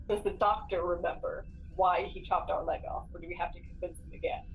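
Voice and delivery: ordinary talk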